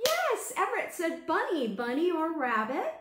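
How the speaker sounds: gasp, then a loud high delighted shout; excited gasp. Real, not acted. Teacher thrilled a child guessed bunny. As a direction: burst with joy at the right guess.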